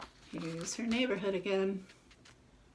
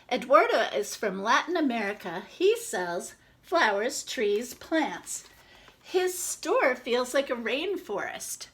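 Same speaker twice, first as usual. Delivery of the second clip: lively storybook read-aloud; reading to children; 2 semitones higher, 4 dB louder than usual. Acted. Reads picture book to children.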